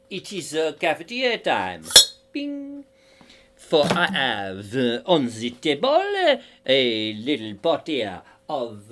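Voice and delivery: slow, hammed-up French accent; mock French accent. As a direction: play at being French, drawing out the words. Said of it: "I'm doing it in a French accent".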